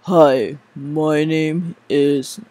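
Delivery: slow, drawn-out voiceover intro; lightly done deep announcer read; deep male voiceover. Shown as a demonstration. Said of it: "you must have a deep male voice".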